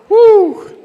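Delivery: high, loud cheering whoop; a big celebratory woo for the room. Real, not acted. Cheering the group.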